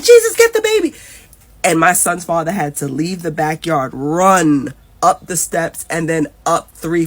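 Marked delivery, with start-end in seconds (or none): 0.0-0.9 very high, loud cry of alarm; panicked onlookers. Retold or imitated. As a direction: imitate women shrieking for help. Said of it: "They were just like".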